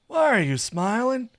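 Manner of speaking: high, loud, put-out question; indignant demand. A narrator's reading. Saphir asking his lover about the smile, indignant. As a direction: demand an explanation from your lover.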